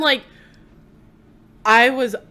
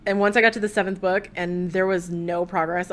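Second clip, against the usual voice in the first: ordinary talk, nothing marked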